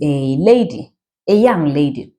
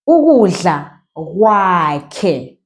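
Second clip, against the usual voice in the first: "Ukudla kwakhe" stretched out slowly; teacher drawing out the phrase; slow demo; much slower than usual. Shown as a demonstration. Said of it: "say every syllable".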